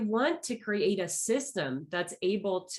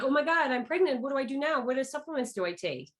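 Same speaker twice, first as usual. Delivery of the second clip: mock-frantic, higher-pitched rush of questions; panicked patient; 6 semitones higher than usual. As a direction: imitate a flustered patient in a mild panic. Acting out panicked newly pregnant patients.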